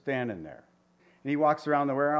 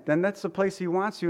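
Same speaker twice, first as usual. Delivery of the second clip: ordinary talk, nothing marked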